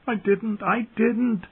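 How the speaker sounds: very high, tearful denial; weeping. A narrator's reading. Bran as the gargoyles come closer, weeping. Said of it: "he wept as they came closer".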